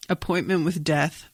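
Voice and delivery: ordinary delivery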